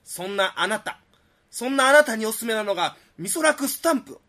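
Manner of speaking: hyped TV shopping pitch; 通販CMの売り口上. Acted. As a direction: sell it like a late-night infomercial. (テレビ通販の売り込み口調)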